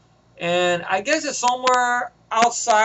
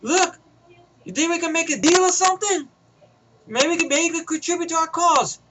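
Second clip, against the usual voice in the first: radio henchman; 9 semitones higher than usual. Retold or imitated. Henchman on the radio calling Pace, worried. Villain offers a deal.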